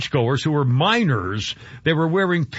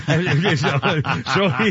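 talking through real laughter; amused, laughing as the sentence starts; much slower than usual. Real, not acted. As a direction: let a chuckle break up the words. Laughs at the mass reporting.

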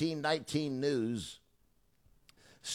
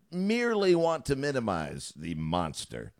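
ordinary talk, nothing marked

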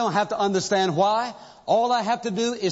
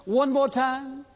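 pleading soldiers; 6 semitones higher, slower than usual. Retold or imitated. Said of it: "They say".

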